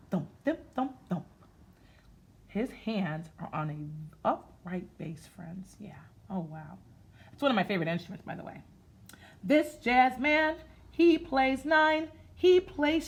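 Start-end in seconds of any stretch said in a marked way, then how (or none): none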